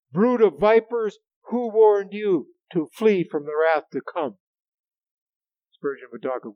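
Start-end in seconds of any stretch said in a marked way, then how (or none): 0.1-4.4 loud, high, slow preaching cry; thundering rebuke. Acted. John the Baptist rebuking the Pharisees, thundering scriptural denunciation. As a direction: hurl the accusation out over a crowd.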